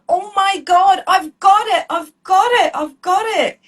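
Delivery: high, loud triumphant cheer; ecstatic. Retold or imitated. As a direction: relive bursting with joy while hugging your husband. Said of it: "I hugged him and I go".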